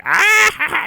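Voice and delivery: short high yell of a movie killer; mock killer yell. Slasher lunging at the girl, high yell.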